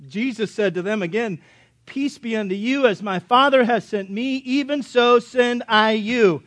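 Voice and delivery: solemn Bible reading; scripture reading. Acted. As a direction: read the verse with weight, slowing at the end. Reads scripture.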